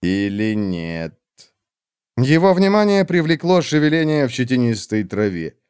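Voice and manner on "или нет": put-on lower, slow cold old witch. Old witch coldly dismissing the warrior, slow and low. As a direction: drag each word out, icy and dismissive.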